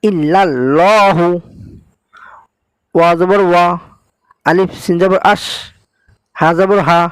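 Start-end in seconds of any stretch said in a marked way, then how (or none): 0.0-1.3 ইল্লাল্লাহু with heavy thick lam, drawn slowly; slow ইল্লাল্লাহু, lam full and heavy. Shown as a demonstration. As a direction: stretch ইল্লাল্লাহু with a dark lam. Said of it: "আল্লাহ পড়বেন, লা পড়বেন না".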